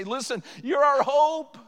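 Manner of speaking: put-on older church member encouraging a young person; encouraging elder. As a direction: put your arm around them and encourage. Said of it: "an older person would ... say".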